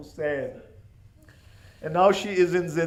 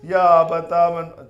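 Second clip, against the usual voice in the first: crying lament; 9 dB louder than usual. Acted. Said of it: "She was crying and crying".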